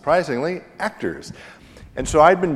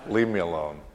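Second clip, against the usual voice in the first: low retort broken by laughter; laughing "leave me alone"; laughing brush-off; 4 semitones lower than usual. Retold or imitated. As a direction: wave them off, chuckling. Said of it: "I had a snappy comeback".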